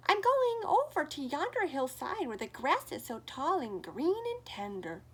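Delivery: lightly voiced tiny goat, hopeful; teeny voice. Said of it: "in his teeny voice".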